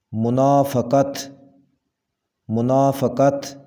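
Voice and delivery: منافقت with "fa": mu-naa-fa-qat; correct pronunciation. Shown as a demonstration. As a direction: slowly model منافقت with an a after f. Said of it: "صحیح تلفظ ہوگا". (منافقت کا صحیح تلفظ، "ف" پر زبر)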